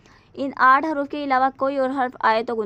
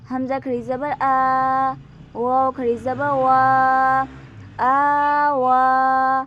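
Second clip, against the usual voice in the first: slow, louder spelling out of letters; spelling drill; 6 dB louder, much slower than usual. Shown as a demonstration. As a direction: spell each sound slowly for a child. Drills Qaida spelling aloud. (बच्चों को धीरे हिज्जे कराते हुए)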